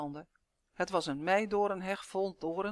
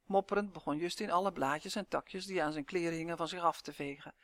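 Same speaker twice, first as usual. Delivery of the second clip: ordinary delivery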